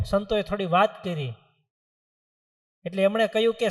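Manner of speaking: normal talking voice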